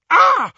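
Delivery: sharp, loud, high cry of pain; a sudden shriek as her fingers burn; scream of pain. Acted. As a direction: Hermione shrieking as the goblet burns her. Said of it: "Hermione screamed in pain".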